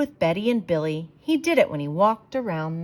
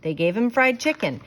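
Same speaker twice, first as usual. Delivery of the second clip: plain reading voice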